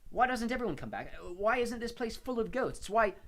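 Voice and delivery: desperate. A narrator's reading. Harry desperate questions to ghost.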